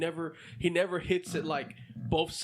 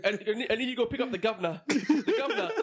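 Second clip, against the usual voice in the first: higher, mock-British "governor"; joking British accent; 5 semitones higher than usual. Retelling a coworker's joke request, mock British accent. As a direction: imitate a mate's British "guv'nah" through laughter. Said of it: "was joking with me saying".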